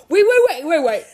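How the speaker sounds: retold panicky call to halt; urgent halt. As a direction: stop everyone with urgent repeated waits. Retold mother halting everyone.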